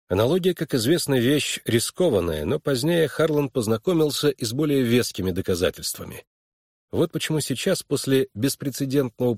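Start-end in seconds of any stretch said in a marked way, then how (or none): none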